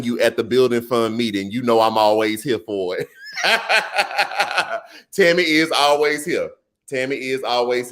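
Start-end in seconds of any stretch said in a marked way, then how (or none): 3.3-4.8 high, delighted laugh; real laugh. Real, not acted. Laughing at Tammy's joke in the chat, a high delighted laugh.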